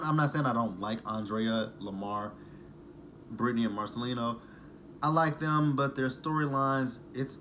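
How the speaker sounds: normal talking voice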